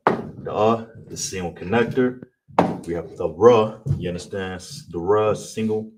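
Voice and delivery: usual speaking voice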